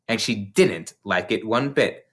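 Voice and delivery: plain reading voice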